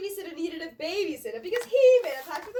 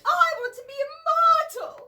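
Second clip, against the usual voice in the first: high, loud, slow pleading whine; whining; 10 semitones higher, 8 dB louder than usual. Acted. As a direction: whine it like a child denied a treat. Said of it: "Silas whined".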